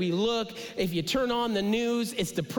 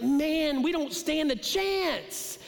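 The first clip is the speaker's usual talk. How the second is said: higher, slow hopeless cry; a hammed-up moan of defeat; mock despair; 8 semitones higher, slower than usual. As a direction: pitch it up like you're overwhelmed. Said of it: "And it's like".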